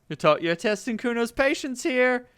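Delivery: high threatening brat; a tiny tough guy warning; bratty kid Kuno. Acted. Kid warns the detective, high and menacing.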